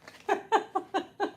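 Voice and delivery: real high, pulsing laugh. Real, not acted. Reader laughing with a client, high real giggles. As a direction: laugh along with them, high-pitched. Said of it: "I'm laughing with you".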